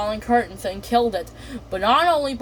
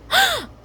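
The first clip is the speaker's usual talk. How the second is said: sharp high gasp. Acted. Delilah sees the rat.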